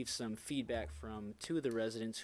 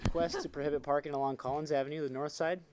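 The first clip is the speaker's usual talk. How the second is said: louder and faster for the room; speaking up; 4 semitones higher, 6 dB louder, faster than usual. Real, not acted. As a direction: project so the back can hear. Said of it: "yeah, just talk loud".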